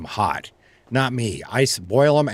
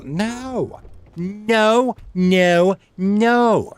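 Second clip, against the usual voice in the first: emphatic no; 10 semitones higher, much slower than usual. Real, not acted. Rejects heated pumpkin idea.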